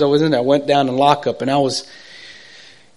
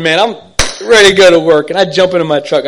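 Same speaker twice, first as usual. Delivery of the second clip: normal talking voice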